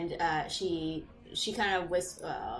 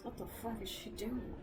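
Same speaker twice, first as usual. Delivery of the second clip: soft, puzzled grumble; muttering patron; 3 semitones higher, 10 dB quieter than usual. Acted. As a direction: mutter behind her back. Patrons muttering to each other.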